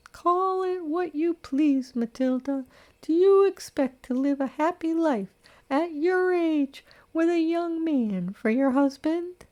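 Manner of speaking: high, prim matron voice, reproachful; a scandalised elderly friend lecturing her; disapproving old lady. Acted.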